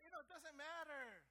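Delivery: loud, rising sermon voice, pushing harder line by line; preaching at full force. Acted. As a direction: preach it out to the whole church.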